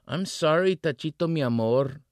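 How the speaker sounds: high, bright flirty call. Acted. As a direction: sing it out playfully to him. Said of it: "she called".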